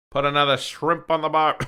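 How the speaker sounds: a loud, hammed-up Australian accent; mock Australian accent. Host mixing up Austria with Australia, mock Australian accent. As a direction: play the jokey Aussie, Australian accent and all. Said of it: "that's Australia".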